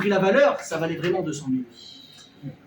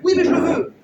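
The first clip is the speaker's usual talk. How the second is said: shouted insistence; 9 semitones higher, 8 dB louder than usual. Acted. Marido insiste gritando.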